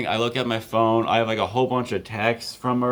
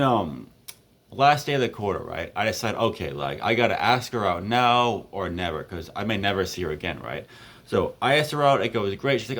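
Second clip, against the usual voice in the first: ordinary talk, nothing marked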